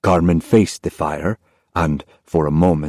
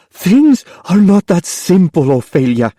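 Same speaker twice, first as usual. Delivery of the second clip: high, sharp, impatient voice; mother impatient with daughter; 12 semitones higher, 6 dB louder than usual. Acted. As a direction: cut her off sharply. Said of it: "It was sharp with impatience".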